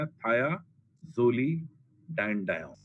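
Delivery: thiazolidinedione spelled out very slowly as thia-zolidine-di-one; broken into parts. Shown as a demonstration. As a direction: break thiazolidinedione into its four chunks slowly, the way a teacher does. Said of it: "चार हिस्सों में तोड़ के पढ़ाते हैं".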